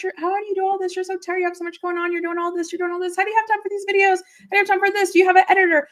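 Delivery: high, eager imitation of a fan; mock fan questions. Mimicking a viewer asking the streamer.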